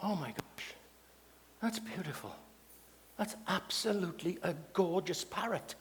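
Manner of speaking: acted soft, awed murmur to oneself; hushed admiration. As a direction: gush very quietly, as if gazing through a shop window. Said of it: "stood in the window of this pet shop like this".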